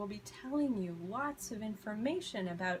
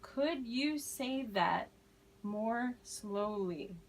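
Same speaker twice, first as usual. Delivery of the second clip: slow, more slowly stretched out most; slow model phrase; much slower than usual. Shown as a demonstration. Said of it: "Someone is speaking too fast".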